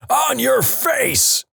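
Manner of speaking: high, slow barked command right in the ear; gravelly shout. Retold or imitated. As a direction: bellow it like a drill sergeant. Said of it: "shouts in his gravelly voice".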